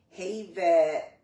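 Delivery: normal talking voice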